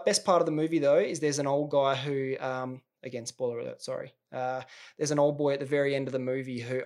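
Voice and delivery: ordinary talk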